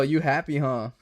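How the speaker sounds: normal talking voice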